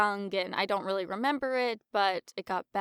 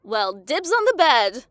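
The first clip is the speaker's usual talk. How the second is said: loud, slow, gleeful call; cheeky loud dibs; playful claim; 8 dB louder, slower than usual. Acted. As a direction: shout dibs like a kid.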